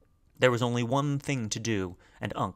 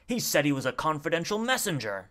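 raised, higher-pitched indignant call; shouted; 5 semitones higher than usual. A narrator's reading. Man at reception protests down the corridor, shouted. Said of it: "shouted a man".

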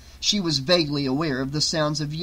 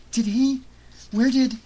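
higher, anxious questions; frightened; 9 semitones higher, 3 dB louder than usual. A narrator's reading. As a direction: ask in fear.

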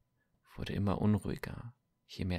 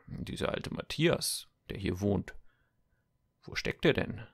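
suspicious guard. Acted.

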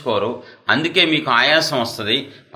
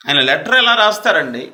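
normal talking voice

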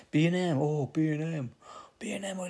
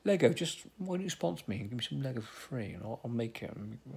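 ordinary talk

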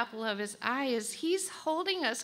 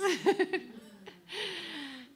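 laugh; 9 semitones higher than usual. Real, not acted. Laughs at eyelashes again.

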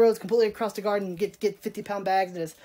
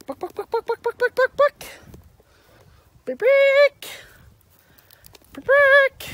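high, climbing hen clucks, getting louder; imitating a clucking chicken, much higher and louder by the end; chicken clucking call; 7 dB louder, slower than usual. Calling the chickens.

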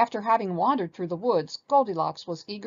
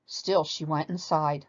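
plain reading voice

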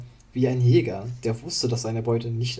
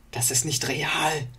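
slow, breathless whisper; whispered to himself; 4 semitones higher, slower than usual. A narrator's reading. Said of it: "sagte er sich". (geflüstert zu sich selbst)

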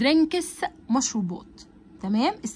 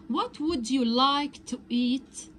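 slow model reading; much slower than usual. Shown as a demonstration. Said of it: "teacher reads waiter line slowly".

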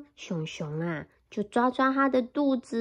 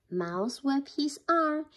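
plain reading voice

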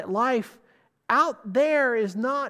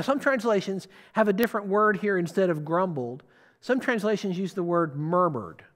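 ordinary talk

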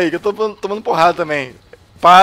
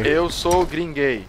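slow teaching voice; much slower than usual. Shown as a demonstration. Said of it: "repeat with me".

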